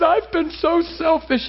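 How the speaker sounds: high, slow tearful confession; mock-tearful, slow and high; retold crying. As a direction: imitate her sobbed confession, pitched high and drawn out. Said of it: "gasping for breath and crying".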